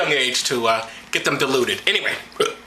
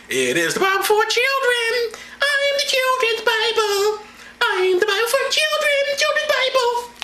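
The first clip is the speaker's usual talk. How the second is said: high, far-off put-on voice of the Bible itself; the book announcing itself in a silly high voice; booming book voice. Comic drinker voices the Children's Bible, high mock voice.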